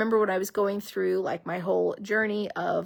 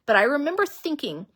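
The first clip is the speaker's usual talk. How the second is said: ordinary talk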